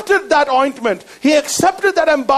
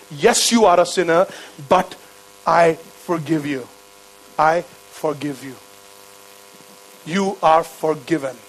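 gentle forgiveness; 6 semitones lower than usual. Retold or imitated. Jesus forgiving the weeping woman, low and tender.

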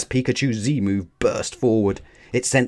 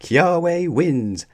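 high, louder cheer; excited cry; 8 semitones higher, 5 dB louder than usual. A narrator's reading. Mallow announcing Kiawe's win, excited cry. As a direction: call out the winner with excitement. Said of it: "Mallow cried".

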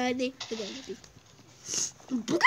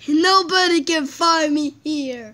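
slow villain boast; 3 semitones lower, 6 dB louder, slower than usual. Pretend. Plays villain hiding in snowman.

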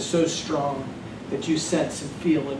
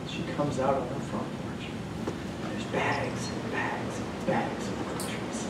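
hushed telling; 3 semitones lower, 10 dB quieter than usual. A narrator's reading. Hushed storytelling build.

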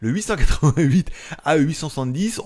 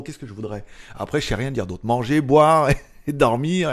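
ordinary talk, nothing marked

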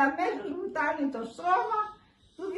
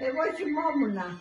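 ordinary talk

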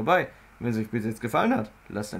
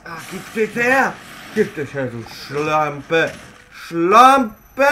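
angry yell; 4 semitones higher, 6 dB louder, much slower than usual. Real, not acted. Yells at an enemy in the game.

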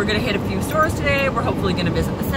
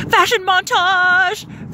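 slow high announcer voice; fashion show host; 10 semitones higher, 3 dB louder, much slower than usual. Pretend.

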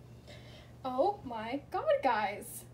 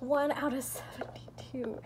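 awed whisper. Real, not acted. Awed at rarity.

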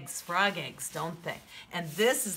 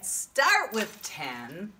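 usual speaking voice

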